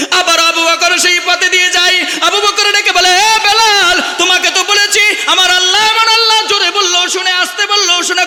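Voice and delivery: high, loud impassioned sermon voice; preaching at full force. Acted. Preacher to his flock, high and loud. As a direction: cry it out over the crowd.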